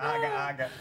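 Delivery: low nonsense catchphrase in character; signature persona noise. Shown as a demonstration. Comic demonstrating his go-to warm-up noise, in character. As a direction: drop into the act's stock babble. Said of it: "That's usually my go-to".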